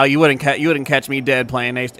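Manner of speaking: ordinary delivery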